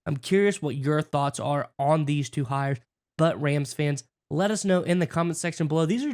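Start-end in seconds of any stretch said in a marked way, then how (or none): none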